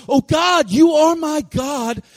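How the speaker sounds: high, loud, drawn-out shout of faith; impassioned prayer shouted slowly and high; crying out to God. Retold or imitated. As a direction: cry out to heaven at full voice. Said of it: "David's crying out like this".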